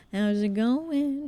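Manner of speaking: slow, drawn-out playful voice; sing-song greeting. Acted. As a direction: croon the greeting lazily. Repeats greeting in a put-on voice.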